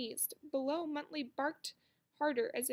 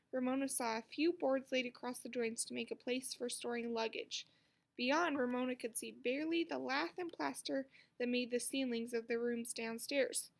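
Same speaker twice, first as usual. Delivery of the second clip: ordinary delivery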